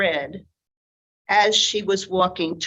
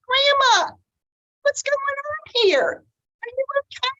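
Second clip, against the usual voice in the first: put-on much higher, anxious little girl calling out. Red calling to her grandmother, high and worried. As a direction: call out like a worried small girl.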